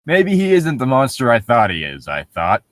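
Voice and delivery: ordinary talk, nothing marked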